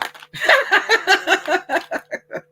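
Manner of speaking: high excited laughing burst; shrill giddy cackle. Real, not acted. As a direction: laugh out loud with delight. Laughs after saying excited.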